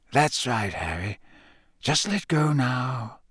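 slow soft reassurance; calm him down, slowly; soothing. Acted. Dumbledore soothing shaken Harry, soft and slow.

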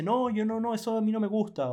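high, loud voice of a picky client refusing; fussy client quoted. Retold or imitated.

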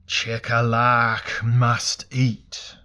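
loud, very slow, drawn-out words; hungry burning god. A narrator's reading. Burning god tells Elric it must eat, booming slowly. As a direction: intone it like a huge ravenous god.

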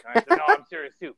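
high, loud defiant retort; yelling back. Retold or imitated. Wrestler mid-match shouts back at the mother, loud and defiant.

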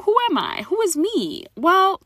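ordinary talk, nothing marked

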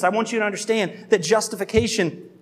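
ordinary talk, nothing marked

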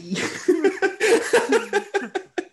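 long high cackle; high burst of laughter. Real, not acted. Laughing at the chimney-sweep bit, high laugh.

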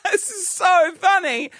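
high, slow fake laughing through tears; forced laughter. Hurt worker laughing with the team, forced mirth. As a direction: laugh along while holding back tears. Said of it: "but you're crying, like, you're holding back tears".

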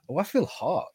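usual speaking voice

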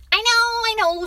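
put-on high, loud child voice; impatient boy. A narrator's reading. Boy cuts off father's caveat, impatient. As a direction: brush off the lecture as a boy.

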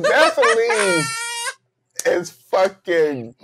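laughing while saying it, slowly; cracking up mid-sentence; laughing exclamation. Real, not acted.

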